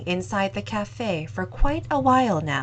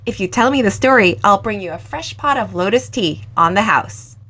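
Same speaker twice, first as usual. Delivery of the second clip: louder, fast, playful bargaining; young owner cleverly bribing the customer, louder and fast; clever coaxing; 9 dB louder than usual. Acted. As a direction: tempt him with a free pot of tea. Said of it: "cleverly added".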